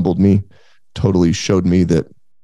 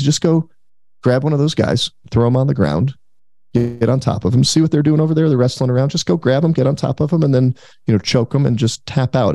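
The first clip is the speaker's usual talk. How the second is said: higher, breezy coach voice; imitating Noah; 5 semitones higher than usual. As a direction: explain it like it's nothing, pitched up. Noah explaining the rules.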